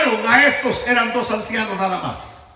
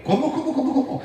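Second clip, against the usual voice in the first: higher, softer repeated questioning; baffled disbelief; 4 semitones higher, 5 dB quieter, faster than usual. Acted. Apostles hearing they are taken for gods, baffled disbelief. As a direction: stammer it out in comic bafflement.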